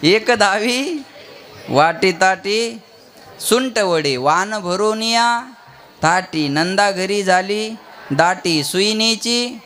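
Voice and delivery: slow, measured recitation for repeating; feed the verse phrase by phrase, slowly; leading a group recitation, unhurried; line-by-line prompting. Shown as a demonstration. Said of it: "तशीच बोला आता".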